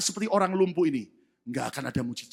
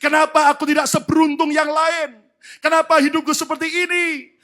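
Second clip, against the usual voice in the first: mock complainer; 9 semitones higher, 9 dB louder than usual. Complainer voiced loudly.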